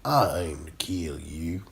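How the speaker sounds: low, slow Southern drawl; Sling Blade voice. Retold or imitated. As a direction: mumble it low and slow like Karl. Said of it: "you'll talk like that".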